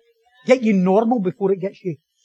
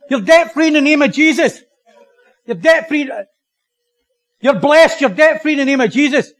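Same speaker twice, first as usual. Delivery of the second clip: high, loud mock proclamation; visiting American prophet; 4 semitones higher, 6 dB louder than usual. Preacher imitating a prophet cancelling debts, mock-booming. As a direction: declare it like a hammed-up prophet.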